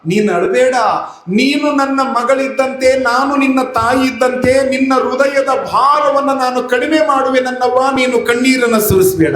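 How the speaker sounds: high, tender consoling voice of a mother; holy mother comforting the weeping wife, high and tender. Acted. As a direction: soothe a weeping daughter.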